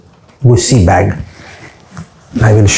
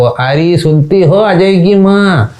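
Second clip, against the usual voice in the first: higher, drawn-out mock call to the wife; 5 semitones higher, slower than usual. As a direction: call slowly across a big house. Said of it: "मैं पुकारूंगा उसको". (पत्नी को लंबी पुकार लगाने का अभिनय)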